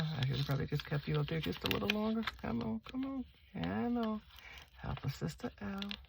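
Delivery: high, soft pleading murmur; soft coaxing. Pretend. Vlogger pleading with her stickers to cooperate, soft coaxing. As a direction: coax it along, high and quiet.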